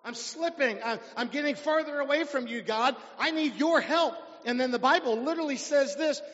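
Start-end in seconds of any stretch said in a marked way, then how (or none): 0.0-0.8 desperate sinner. Acted. Preacher voicing a sinner crying out to God, pleading. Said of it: "This guy actually said". 0.8-4.2 higher and louder, a sinner begging for help; desperate plea to God. Acted. Preacher voicing a sinner begging God for help, loud pleading. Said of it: "This guy actually said".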